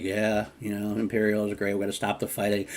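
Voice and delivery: softly mumbled token agreement; mock submissive. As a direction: give in quietly, imitating someone rolling over. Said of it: "rolling over and showing his belly".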